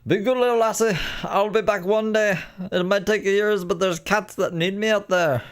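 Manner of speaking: high-pitched, hammed-up Irish lilt; bad Irish accent. Streamer ribs the Irish cat lady, bad Irish accent. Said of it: "a fucking Irish accent".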